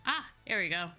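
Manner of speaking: ordinary talk, nothing marked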